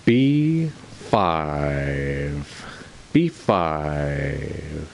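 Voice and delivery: low announcer call, lightly voiced; bingo caller. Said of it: "okay job to be the bingo caller".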